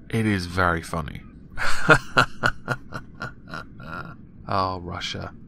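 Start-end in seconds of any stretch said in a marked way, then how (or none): 1.5-4.1 laughing. Real, not acted. Laughing at sexist ad.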